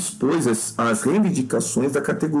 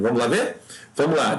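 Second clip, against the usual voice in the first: ordinary talk, nothing marked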